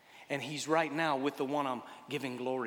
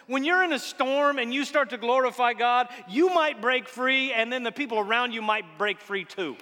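loud, high, exultant sermon climax; full-force preaching; 8 semitones higher, 8 dB louder than usual. Acted. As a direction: proclaim it with rising fire.

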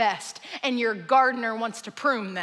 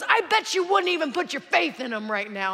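raised, higher goading voice; screaming match; 3 semitones higher than usual. Acted. Norm daring the stranger to believe, yelling. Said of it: "they begin a screaming match".

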